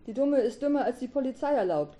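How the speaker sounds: high, loud sing-out jeer; acted gleeful boy mocking his sister; giggled taunt. A narrator's reading. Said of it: "kicherte Edgar".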